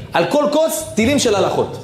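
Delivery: high, fervent preaching burst; soaring preacher's exclamation; excited climax. Acted. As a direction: lift it up with wonder.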